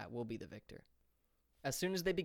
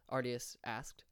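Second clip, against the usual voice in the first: ordinary delivery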